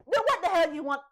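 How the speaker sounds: loud, irritated demand; exasperated demand. Retold or imitated. Ralph losing patience with Gemma, loud irritation. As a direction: snap at her in frustration.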